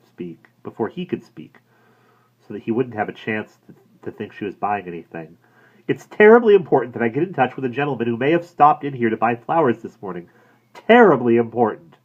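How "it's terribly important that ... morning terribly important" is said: hurried anxious pleading, a little loud; desperate to be believed; urgent plea. A narrator's reading. Woman pressing the florist for news, anxious and breathless. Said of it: "She stopped for breath".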